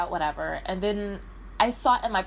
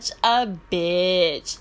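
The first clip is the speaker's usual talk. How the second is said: teasing best friend; 3 semitones higher, 5 dB louder than usual. Retold or imitated. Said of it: "She was like".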